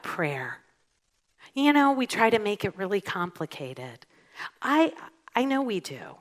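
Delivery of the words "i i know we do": normal talking voice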